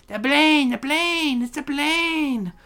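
high, squeaky put-on announcing cry; hammed-up high call, stretching the last one; exaggerated little lookout voice; excited plane-spotter impression.

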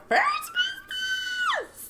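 high, slow, crying-out cheer; excited shout. Real, not acted. Friend announcing her first business, shouting with joy. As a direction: belt it out like big news.

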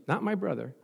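usual speaking voice

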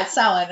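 Samhain said SOW-in; correct Irish pronunciation. Retold or imitated. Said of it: "he was like".

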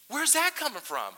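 high, loud amazed question; acted astonishment. Pastor reacting to friend's kind message, acted astonishment. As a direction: blurt it in mock amazement.

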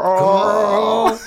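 high, loud, long-drawn mock exclamation; imitating a judge. Judge reacting to five thousand dollars, hammed-up imitation. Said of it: "And she's like".

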